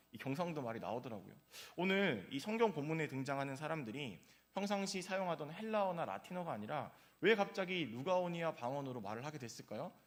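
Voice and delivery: ordinary talk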